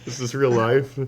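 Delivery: laughing in disbelief; cracking up at the prank. Real, not acted.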